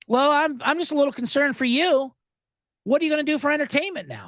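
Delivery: high, fast, mock-sweet concern; a syrupy concerned agent; sweet concerned rep. Comic quoting the rep's fake concern, high and quick. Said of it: "That's what she said".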